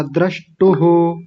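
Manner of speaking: द्रष्टुः slowly, ending in an echoed "hu"; visarga pronounced. Shown as a demonstration. Teacher correcting a dropped visarga, द्रष्टुः with audible final "hu". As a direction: sound the final breath of द्रष्टुः as "hu". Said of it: "विसर्ग छोड़ दिया था".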